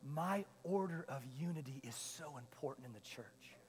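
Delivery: quiet, weighty divine reply; voice of the Lord. Retold or imitated. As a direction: speak as God, solemn and hushed. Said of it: "And the Lord goes".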